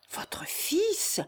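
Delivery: hushed question leaping high at the end; moved surprise. A narrator's reading. Peasant learning the young man is the son, moved surprise. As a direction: let astonishment lift the voice softly. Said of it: "avec un peu d'émotion".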